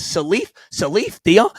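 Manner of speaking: questioning "Salif Dion", mimicking the mishearing; retold mispronounced snap. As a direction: imitate a baffled "Salif Dion?", slow and rising. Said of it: "so he snaps".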